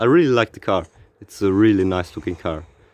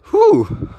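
high relieved whew; 5 dB louder than usual. Real, not acted. Relief after a pull.

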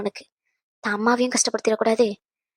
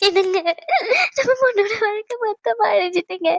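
crying; 10 semitones higher than usual. Acted. Mother weeping over daughter's wedding.

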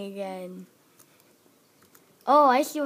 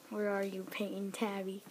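hushed whispered call; whispering; 4 semitones lower, 10 dB quieter than usual. Acted. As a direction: whisper the name softly. Searching for hiding player.

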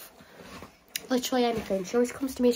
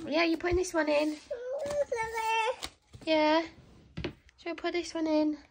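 lightly higher sing-song coo; 5 semitones higher than usual. Real, not acted. Mum dressing the baby, light baby talk. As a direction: chat to the little one sweetly.

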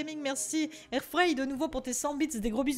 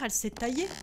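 usual speaking voice

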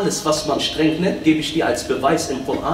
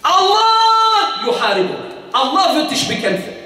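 forceful preaching; 4 dB louder than usual. Real, not acted. Preacher warning of Allah's fight, forceful. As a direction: preach it loud and high.

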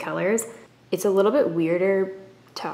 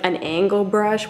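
ordinary delivery

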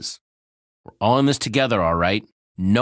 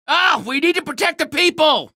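much higher, louder alarmed cry; urgent shout; 4 dB louder than usual. Acted. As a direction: shout it out to rally everyone. Urgent cry to protect town.

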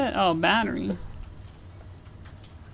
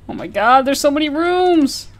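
loud, higher exasperated cry; overwhelmed exclaim; 5 semitones higher, 7 dB louder than usual. Real, not acted. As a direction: blurt it in disbelief. Overwhelmed by huge hotel.

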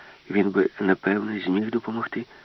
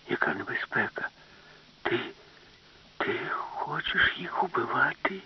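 slow, softer whisper; hushed alarm; 5 semitones higher, 7 dB quieter than usual. Acted. Professor whispers his alarm to Alice, slow and hushed. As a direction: whisper the shock slowly.

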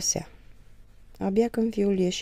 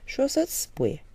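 sarcastic; 7 semitones higher, 6 dB louder than usual. Acted. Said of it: "îl lămuri Moromete sarcastic".

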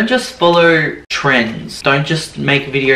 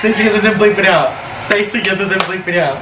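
1 playful pushing; 6 semitones higher, faster than usual. Real, not acted. Pushing friend to say name.

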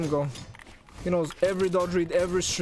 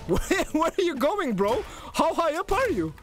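high-pitched, exclaimed disbelief; incredulous calling out; 10 semitones higher than usual. Real, not acted. Player asks opponent where he is going, incredulous. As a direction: call after someone in disbelief.

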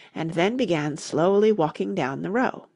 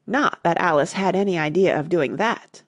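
plain reading voice

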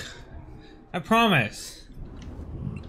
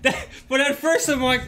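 high, laughing through the words; 7 semitones higher than usual. Real, not acted. Laughing about being called small, amused and breaking up. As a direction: talk through giggles.

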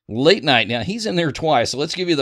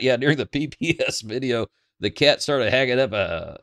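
ordinary delivery

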